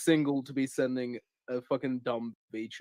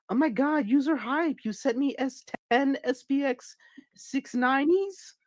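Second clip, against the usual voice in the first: high, excited squeal; gushing girly voice; 5 dB louder than usual. Retold or imitated. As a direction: gush like a thrilled streamer. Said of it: "she was like".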